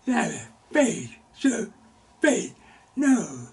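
loud, high preaching build. Acted. Preacher proclaiming faith to the church, loud and high. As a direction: drive the point home to the congregation.

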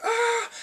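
quick high mock fright cry; high mock scream. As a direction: shriek like someone seeing a scarecrow.

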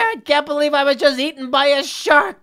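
loud, high shouting of relief; overjoyed relief. Pretend. Player freed from the shark shouting to viewers in loud relief. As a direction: yell it like you just escaped death.

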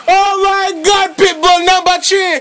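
high-pitched, elated sports commentator; ecstatic goal call. Real, not acted. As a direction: go wild over the goal. Commentator celebrates Pitbull's goal.

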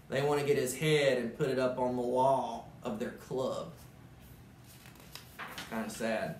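usual speaking voice

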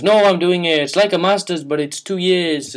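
lightly voiced stranger; mocking the guy. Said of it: "And he goes".

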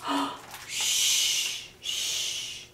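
soft breathy "shh"; gasp, then hush gently, twice. Acted. Reader hushes for sleeping squirrels, soft shushing.

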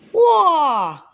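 high, loud, falling whoa; startled whoa. A narrator's reading. Father sees son removing a sock, startled exclamation. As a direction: call out a sudden halt.

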